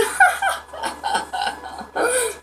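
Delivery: high airy wheezing laugh; breathless laugh. Real, not acted. Laughing at flying away.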